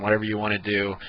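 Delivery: usual speaking voice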